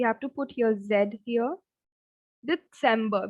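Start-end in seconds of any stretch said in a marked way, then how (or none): 2.5-3.3 December with a slight T before the S sound; German December. Shown as a demonstration. Teacher models German December with a slight T inside. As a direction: say December as 'Det-sem-buh'. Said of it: "We have a slight T here".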